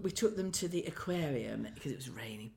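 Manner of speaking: hushed under the breath; whispering. Real, not acted. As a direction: drop to a whisper. Aside in a whisper.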